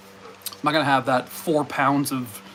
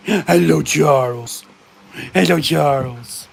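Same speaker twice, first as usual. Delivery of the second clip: mock posh greeting; 4 dB louder, slower than usual. Real, not acted. Greeting dog in put-on voice.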